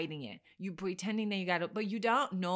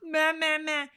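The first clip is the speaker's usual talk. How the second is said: hammed-up whiny clinginess, higher; mocking blah blah; 5 semitones higher, 4 dB louder than usual. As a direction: whine it like your needy past self. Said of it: "I was like".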